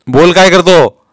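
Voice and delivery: loud, high demand; bullying interrogation; impatient inspector. A narrator's reading. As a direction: press hard for an answer. Inspector presses the silent boy.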